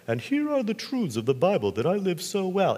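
mock pious preacher. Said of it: "I pretend to be able to".

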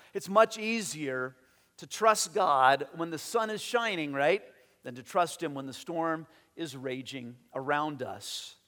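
normal talking voice

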